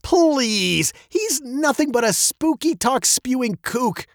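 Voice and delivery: high-pitched scoffing; amused dismissal. A narrator's reading. Father dismisses the old man as a kook, scoffing. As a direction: wave the idea away as nonsense. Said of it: "her father laughed".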